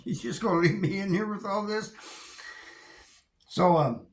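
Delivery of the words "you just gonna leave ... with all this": high, mock pleading voice; lightly hammed-up plea to the guards; begging plea. Left in the pepper-sprayed cell, mock pleading with guards. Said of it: "I'm begging on".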